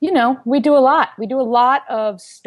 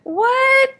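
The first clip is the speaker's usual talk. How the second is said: disbelief. Retold or imitated. Stunned on hearing dentist's brother.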